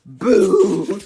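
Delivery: sudden loud scare shout; loud boo. Acted. Little Miss Scary frightens people, loud boo. Repeated scare boos in story.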